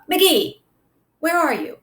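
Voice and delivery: high call into the dark. A narrator's reading. Mars Bar searching the dark, calling out. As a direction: call across the pen.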